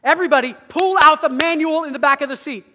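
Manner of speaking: loud, harsh flight attendant command; stern shout. Retold or imitated. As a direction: bark the order at the whole cabin. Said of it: "all of a sudden are not friendly".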